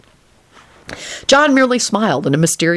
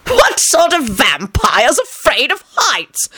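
bellowed; 8 semitones higher, slower than usual. A narrator's reading. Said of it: "John bellowed".

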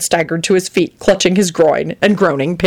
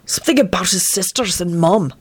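ordinary delivery

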